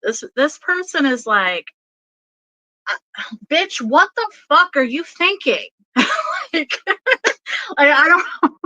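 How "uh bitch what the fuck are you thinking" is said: high-pitched, exasperated telling-off; incredulous mock yell; channelled yelling. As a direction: act out an outraged spirit scolding. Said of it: "this person's yelling at me".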